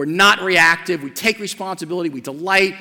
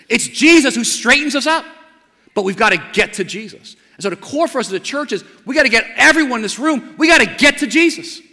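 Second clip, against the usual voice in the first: rising, louder preaching on Jesus; full-force preaching; 7 semitones higher, 6 dB louder than usual. Acted. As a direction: preach it out, building to a peak.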